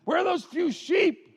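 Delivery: high-pitched, jeering question; taunting big brother. Acted. Jealous Eliab belittling David the shepherd, taunting.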